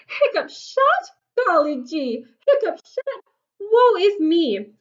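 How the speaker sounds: high, loud mock panic; hammed-up dread of the needle; alarmed. Papa fears a shot.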